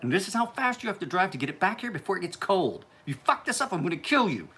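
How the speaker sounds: lightly voiced bossy sister laying down dinner orders; Sarah Emler impersonation. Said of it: "was that a good impersonation".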